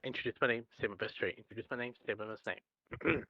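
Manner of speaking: soft nervous self-talk; nervous muttering. Acted. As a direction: mumble the lines to yourself. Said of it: "as I walk over".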